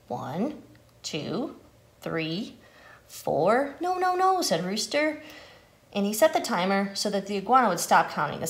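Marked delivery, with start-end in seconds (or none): 0.1-3.8 low, measured counting, one number at a time. Acted. Iguana counting the seconds for the oven, counting off. 3.8-4.4 high-pitched, hurried objection; urgent interruption. Acted. Rooster stopping Iguana's counting, urgent interruption.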